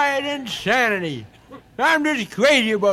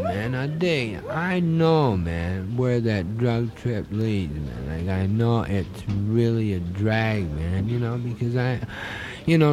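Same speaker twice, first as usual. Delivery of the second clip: stoned hippie voice; 9 semitones lower than usual. Acted.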